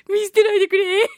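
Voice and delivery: high, loud mock plea of a husband; cling and beg not to be left; clingy pleading husband. Husband clinging to his listener wives, begging not to be dumped. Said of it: "すがる系でございますからね".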